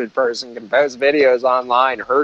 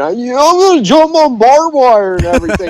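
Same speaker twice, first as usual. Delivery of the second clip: high, bright mock kid voice raised well above own; a goofy kid voice pitched up high; mock excited kid; 10 semitones higher, 4 dB louder than usual. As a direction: ham up the dumb stunt kid. Mocking the barbed-wire kid.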